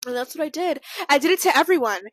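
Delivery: ordinary talk, nothing marked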